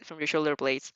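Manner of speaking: ordinary delivery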